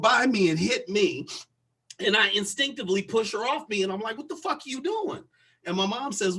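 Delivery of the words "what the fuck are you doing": high-pitched retold outrage; indignant confrontation. Pushing off the woman who hit her, indignant. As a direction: demand it in high disbelief.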